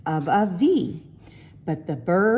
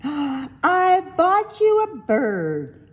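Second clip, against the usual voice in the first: high, very slow gasp then the reveal; gleeful reveal with a gasp; delighted gasp; 9 semitones higher, much slower than usual. Acted. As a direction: gasp with delight and unveil the gift slowly. Mother reveals surprise gift.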